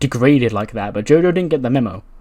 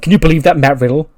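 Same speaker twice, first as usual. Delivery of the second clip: louder mock quote; 4 semitones higher, 7 dB louder, faster than usual. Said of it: "And so it's like".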